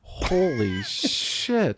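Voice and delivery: very slow, drawn-out astonishment; long, incredulous reaction; real amazement. Real, not acted. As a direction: stretch each word in stunned disbelief. Listener reacts to the count.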